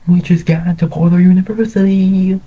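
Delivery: very high, gleeful announcement; excited announcement. A narrator's reading. Wyatt and Tegan tell their dads together, excited. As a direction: sing out the big news at a high pitch.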